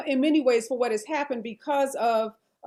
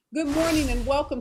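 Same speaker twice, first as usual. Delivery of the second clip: ordinary delivery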